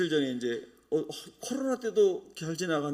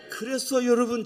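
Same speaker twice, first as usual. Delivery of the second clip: normal talking voice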